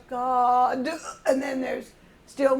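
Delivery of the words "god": GOD with a long vowel and a heavy "duh" ending; exaggerated final D. Shown as a demonstration. Teacher warning the chorus off putting the D on, exaggerated final D. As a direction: draw out "God", then land hard on the D. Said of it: "don't put your D on".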